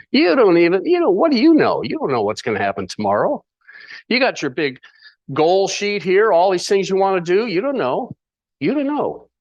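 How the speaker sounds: high, incredulous mock challenge; scoffing inflection. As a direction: swoop up high as if putting someone in their place. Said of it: "that's how he would say it".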